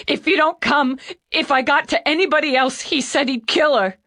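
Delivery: put-on panicked young man crying hard; hiccuping tears. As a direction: plead through loud hiccuping sobs. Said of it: "between sobs and shakes".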